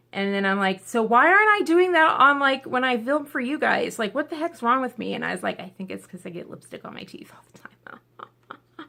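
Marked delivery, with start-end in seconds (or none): 0.8-5.1 raised, louder baffled self-quote; exasperated self-questioning. Retold or imitated. 5.6-8.9 whispered sheepish admission; sheepish confession. Retold or imitated. Sheepish answer to own question.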